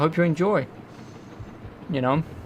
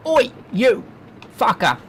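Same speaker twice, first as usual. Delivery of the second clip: higher, louder hammed-up English "oi"; mock English accent; 9 semitones higher, 4 dB louder than usual. Gamer playing up being English, mock English accent yell. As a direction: call out in an exaggerated English accent. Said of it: "trying to do an English accent".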